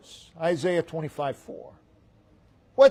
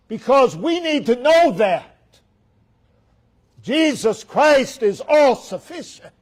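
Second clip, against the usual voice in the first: loud and higher, pressing each claim; rising sermon climax; preaching at full force; 4 semitones higher, 6 dB louder than usual. Acted. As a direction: drive it home to the congregation.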